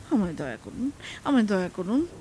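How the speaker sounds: low-pitched, humble begging; pleading. A narrator's reading. Habu begs the magician for mercy.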